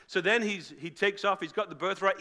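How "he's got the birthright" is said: ordinary talk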